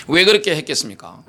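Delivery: normal talking voice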